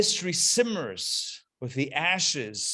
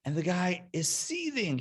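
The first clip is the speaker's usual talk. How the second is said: ordinary talk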